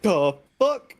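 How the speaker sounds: loud, sharp mock shock; startled by a roach on his chest; startled outburst. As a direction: jolt awake and blurt it. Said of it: "I was like".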